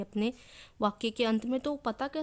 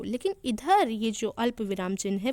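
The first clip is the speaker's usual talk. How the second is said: ordinary talk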